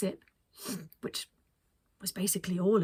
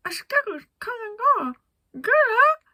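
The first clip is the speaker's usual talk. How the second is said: lips barely moving; 14 semitones higher, 11 dB louder, faster than usual. Acted. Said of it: "trying not to move her lips".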